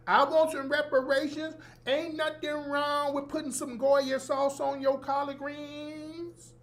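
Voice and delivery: high mock Southern preacher chant; fake Southern inflection. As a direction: mockingly intone it like a Southern preacher. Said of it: "your fake Southern voice inflections".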